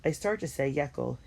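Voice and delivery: usual speaking voice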